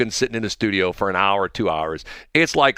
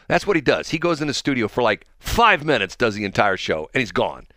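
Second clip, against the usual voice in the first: normal talking voice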